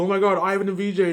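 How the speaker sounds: high, loud starstruck squeal of a passerby; excited fan gushing. Retold or imitated. Imitating a starstruck fan seeing him and his girlfriend at the club, loud and high. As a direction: gush like a fan spotting celebrities.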